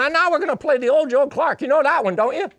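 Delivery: put-on high, loud and fast like the old banjo maker; old man eagerly calls the next tune; old man's voice. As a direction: sell the tune with eager glee. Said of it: "And then he goes".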